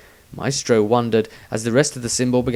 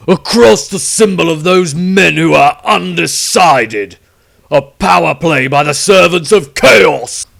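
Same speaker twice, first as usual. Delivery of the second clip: loud, slow, raging accusation; furious; 6 semitones higher, 11 dB louder, slower than usual. A narrator's reading. Witch hunter raging at the chaos symbols, loud fury. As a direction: rant it out furiously. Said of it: "he furiously continued".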